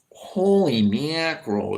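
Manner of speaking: put-on slow, drawn-out Ohio accent; mocking the Ohio accent as annoying. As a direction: drawl it out like a mock Ohio local. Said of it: "here it's more like".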